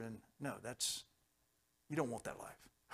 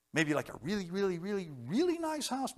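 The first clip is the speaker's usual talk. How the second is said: each really climbing higher until a very high really nice house; building really; 6 semitones higher than usual. Acted. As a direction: stretch the really's upward for the joke. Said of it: "I often joke about it".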